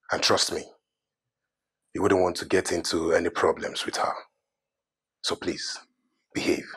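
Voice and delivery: ordinary delivery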